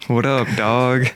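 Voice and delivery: What up, dog? dragged slowly; slow slangy What up, dog; slow drawn greeting. Retold or imitated. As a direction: stretch it out lazily. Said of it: "we say".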